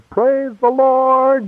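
high, drawn-out mock praise cry; mock loud praise. Imitating Christians singing out praise across campus, mock loud praise. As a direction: imitate loud believers stretching each word high. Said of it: "singing out loud at the top of their vocal cords".

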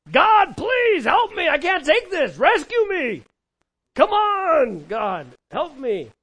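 desperate shouted plea, high and drawn out; full-force scream for rescue in prayer; crying out to God. Acted. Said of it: "I imagine him just crying out to God".

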